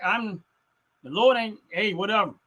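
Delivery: ordinary delivery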